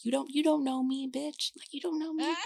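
an angry teen telling off authority, mock defiant; mock teen defiance. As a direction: sass back like a rebellious teenager. Teen self's defiant retort.

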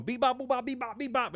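high sing-song beep bop; playful punch noises; mock punch sounds. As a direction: tap out the beating like a cartoon. Imitating punches landing.

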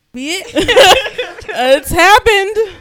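high, loud laughing, slowing on happened; laughing resignation. Real, not acted. Realising she is pregnant, laughing high and loud.

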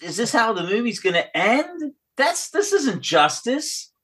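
high, incredulous viewer protest; indignant disbelief. Acted. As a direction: voice a mock-outraged moviegoer, pitch climbing. Voicing an indignant movie viewer's reaction.

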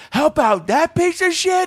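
high slow disbelieving shout; angry yell. Retold or imitated. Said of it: "he goes".

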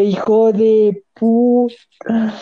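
drawn-out, disbelieving curse; slow stunned curse. Retold or imitated. Telling friends how he reacted to seeing her, slow stunned curse. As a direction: let it crawl out in slow shock. Retold own shocked reaction.

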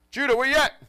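put-on loud, high call for a missing boy. Father calling his hiding son, loud and high. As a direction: shout his son's name across the house.

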